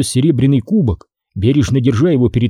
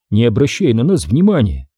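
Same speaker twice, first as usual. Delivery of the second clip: plain reading voice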